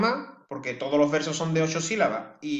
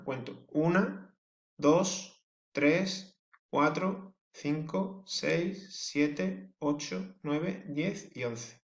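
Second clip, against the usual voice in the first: slow syllable count, each number lifted at the end; 6 dB quieter, much slower than usual. Shown as a demonstration. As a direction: count the syllables out one by one, slowly. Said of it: "las cuento como una".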